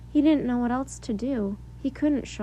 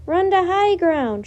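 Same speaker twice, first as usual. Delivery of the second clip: loud, higher, slow command; shouted; 6 semitones higher, 7 dB louder, slower than usual. A narrator's reading. Boy shouting to the crowd to run uptown, loud. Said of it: "shouted Jack".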